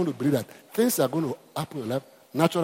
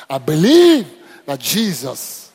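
slow declaration; 9 dB louder, much slower than usual. Shown as a demonstration. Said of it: "I want to say that".

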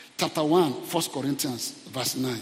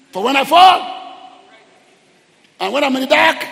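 loud, high, slowing proclamation; defiant shout; 12 semitones higher, 13 dB louder than usual. Acted. Preacher declaring scripture over his falls, defiant shout.

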